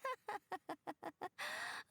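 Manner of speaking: high teasing laugh; amused laugh. Acted. At the tricked pair's faces, a high teasing laugh. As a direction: laugh at their shocked faces.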